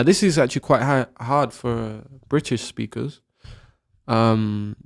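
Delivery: usual speaking voice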